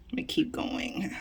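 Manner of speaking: breathy whisper under the breath; muttered whisper while scrolling on; whispered aside. Real, not acted. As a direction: whisper it to yourself, half to the room. Aside while reading the list.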